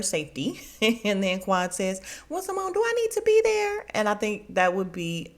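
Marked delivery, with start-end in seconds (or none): 2.3-3.9 very high-pitched comic asking; hammed-up high little query; imitated pointed question. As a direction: pipe up, angling to be invited. Imitates Quad asking to be included.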